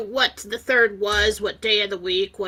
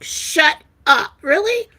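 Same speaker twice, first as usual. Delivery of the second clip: loud, high-pitched astonishment; thrilled disbelief; 8 semitones higher, 8 dB louder, slower than usual. Retold or imitated. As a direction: exclaim it, voice leaping up. Acts her stunned reaction to news.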